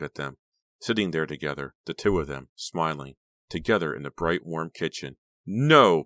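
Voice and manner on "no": loud, high anguished wail. A narrator's reading. Becca wailing at the pair in the kitchen. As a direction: let the no tear out as a wail. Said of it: "Becca wailed".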